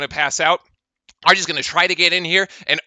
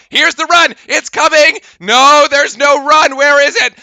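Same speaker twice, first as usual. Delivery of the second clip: higher, louder frustrated call; exasperated hype; 6 semitones higher, 5 dB louder than usual. Real, not acted. Fan commentator begging Duke for a run, exasperated. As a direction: demand the run.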